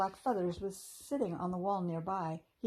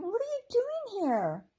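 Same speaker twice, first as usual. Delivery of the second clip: high, louder surprised fairy question; happy surprise; 6 dB louder than usual. A narrator's reading. Fern greeting the squirrel who followed, high happy surprise. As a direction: ask in glad surprise.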